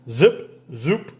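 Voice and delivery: ordinary delivery, nothing marked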